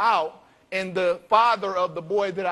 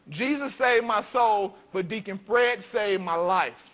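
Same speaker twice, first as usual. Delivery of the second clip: usual speaking voice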